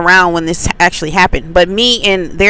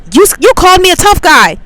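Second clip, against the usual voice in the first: mock accusation; 9 semitones higher than usual. Retelling the angry man's accusation.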